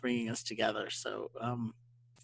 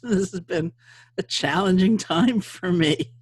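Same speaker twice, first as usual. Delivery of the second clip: higher and louder, slow, laughing through it; laughing admission; 6 semitones higher, 8 dB louder, slower than usual. Real, not acted. To the host, laughing about being tech challenged. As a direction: laugh it off as you admit it.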